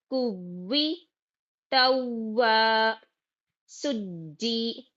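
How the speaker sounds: ordinary talk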